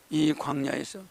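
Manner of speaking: ordinary talk, nothing marked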